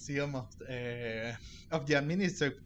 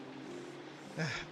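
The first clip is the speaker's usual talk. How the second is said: sigh; 9 dB quieter than usual. Real, not acted. Streamer sighs before answering.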